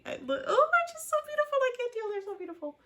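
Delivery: swooning over the couple; gushing fangirl squeal. Real, not acted. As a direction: squeal it like you can't handle the cuteness.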